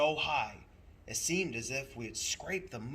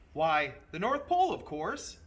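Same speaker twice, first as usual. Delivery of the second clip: louder, higher conductor; 4 semitones higher, 8 dB louder than usual. Acted. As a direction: announce it like it's obvious. Conductor answers boy.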